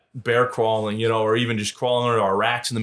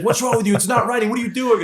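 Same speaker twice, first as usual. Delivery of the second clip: drill instructor yelling; 11 semitones higher than usual. Retold or imitated. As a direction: bark it like a screaming DI.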